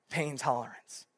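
normal talking voice

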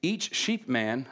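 ordinary delivery

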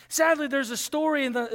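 normal talking voice

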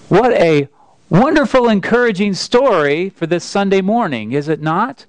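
high, mock-cheerful irony; sarcastic. Real, not acted. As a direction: sound brightly ironic about the grim tale. Ironic remark after grim story.

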